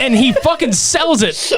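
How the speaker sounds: high-pitched, laughing through the words; amused laughing. Real, not acted. Host describing the fake shotgun shot, laughing. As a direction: tell it while cracking up.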